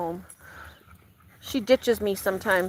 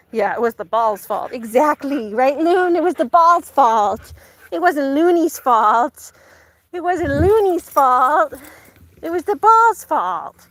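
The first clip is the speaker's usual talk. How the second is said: high, louder, slow sing-song; 4 semitones higher, 6 dB louder, slower than usual. Real, not acted. Owner teasing her dog that the ball is to blame, high sing-song. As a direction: tease the ball's fault to the dog.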